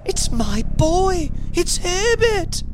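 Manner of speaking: put-on very high old woman's cry; cried out. A narrator's reading. Mother struggling to reach her son, crying out. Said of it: "she cried".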